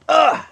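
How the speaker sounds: high, loud mock groan of disgust. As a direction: groan like you're fed up. Fed up with commenters.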